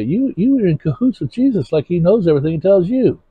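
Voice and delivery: high, mocking sneer; mocking accusation. Quoting people scorning the pastor, high mocking voice. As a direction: mimic a scornful accuser, pitch up. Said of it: "I've had people say".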